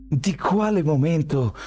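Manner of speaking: high, strained grunt of a question. A narrator's reading. Miles lunges at Daniel, strained grunted question. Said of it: "grugnì Miles".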